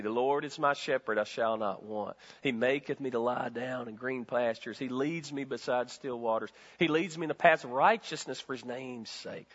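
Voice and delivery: scripture recitation. Acted. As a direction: recite it gently like a prayer.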